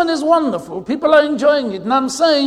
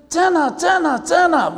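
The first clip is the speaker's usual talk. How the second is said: calling out; 6 semitones higher than usual. Retold or imitated. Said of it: "so I call them from the bus door".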